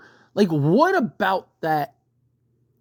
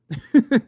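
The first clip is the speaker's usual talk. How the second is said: short laugh; 6 semitones higher, 4 dB louder than usual. Real, not acted. Laughing at own dislike.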